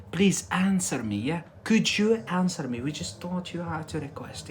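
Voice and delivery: ordinary delivery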